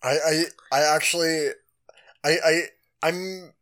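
real stammer, repeated starts; stuttering. Real, not acted. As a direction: trip over the first words. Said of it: "well, I'm stuttering a lot".

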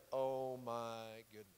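low, very soft, slow disbelief; appalled wife. Acted. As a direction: say it under your breath, drawn out and dismayed. Disapproving wife watching David dance.

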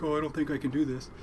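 lightly feigned nerves; exaggerated self-doubt. Nephew pranks his uncle at dinner, feigning doubt. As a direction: play up doubt to fool someone, retold. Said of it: "I kind of exaggerated that quite a bit".

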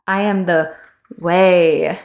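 way pushed out with a laugh; stress on WAY. Shown as a demonstration. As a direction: land hard on way, laughing. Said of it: "emphasize specific words".

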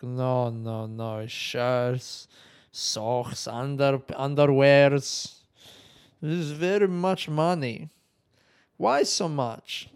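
mock broken English, slow and drawn out; imitating Greek agent.

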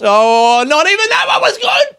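very high, squealed comic lament; mock despair. Scolding own failed voice, high mock despair. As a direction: shoot up loud into a squeaky wail.

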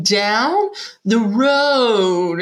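Down the road, each syllable drawn out very slowly; slow, exaggerated local giving directions; stretched-out slow repeat. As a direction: stretch every syllable like talking to a foreigner. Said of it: "stretching their syllables out".

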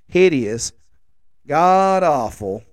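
slow, drawn-out disgusted verdict. Real, not acted. Dad judging his ugly shirt, drawn-out disgust. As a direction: let the disgust ooze out slowly.

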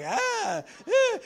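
high, loud, hammed-up "ah, eh" of relish; mock pleased tasting noise. Preacher mimicking Satan's sweet bait, a high mock relish. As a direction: smack your lips like the devil's treat tastes great.

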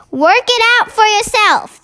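high, emphatic queenly retort; curt retort. A narrator's reading. Queen rebuffs the baffled Air Force head, high and curt. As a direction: answer back high and firm.

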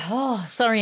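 ordinary talk, nothing marked

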